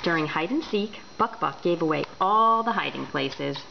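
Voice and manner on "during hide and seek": ordinary delivery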